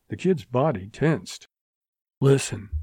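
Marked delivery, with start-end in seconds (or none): none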